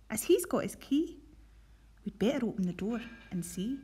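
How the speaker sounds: put-on lively read-aloud storytelling for children; reading a Scots picture book to pupils; story narration. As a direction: tell it brightly to a school assembly. Teacher reads Scots story aloud.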